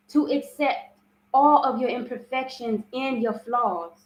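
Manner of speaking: ordinary talk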